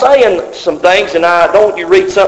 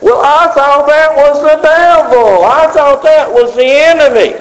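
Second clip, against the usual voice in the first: mock objector; 8 semitones higher than usual. Preacher voicing the congregation's objection, mock surprised. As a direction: play the surprised believer who blamed the devil. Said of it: "you think".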